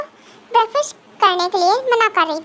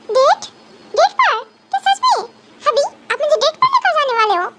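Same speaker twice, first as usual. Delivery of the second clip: high, delighted disbelief; light up at the news of a date; wife suddenly thrilled about the date; excited surprise; 5 semitones higher than usual. Acted. Sulky wife hears of a date.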